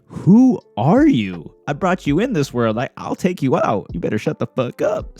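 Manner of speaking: hammed-up furious mother threat; furious mom. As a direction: threaten your kids like an enraged mom. Siyun is looking at them like.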